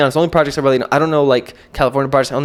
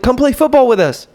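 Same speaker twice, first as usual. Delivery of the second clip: put-on little boy voice; 10 semitones higher, 3 dB louder than usual. Little boy inviting him to play.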